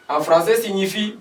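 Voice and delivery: ordinary delivery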